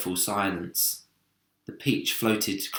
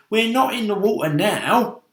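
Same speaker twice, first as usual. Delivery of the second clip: mocking bug voice; high loud scoffing centipede; 10 semitones higher, 7 dB louder than usual. Centipede mocking the earthworm, high loud scoff.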